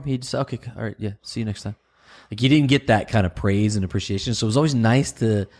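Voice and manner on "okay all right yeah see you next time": lightly voiced offhand dude, softer; unimpressed male customer. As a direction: brush it off quietly like an indifferent customer. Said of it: "But if you had a dude".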